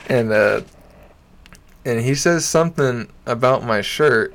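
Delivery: usual speaking voice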